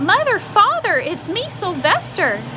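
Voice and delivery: high, loud cry to his parents; a son crying out to mother and father; longing shout. A narrator's reading. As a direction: call out desperately from inside the rock. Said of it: "How he wanted to shout".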